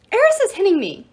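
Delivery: high, loud whining complaint; crying out. A narrator's reading. Child complaining of being hit, high cry. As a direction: tattle on a sibling loudly. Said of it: "cried someone".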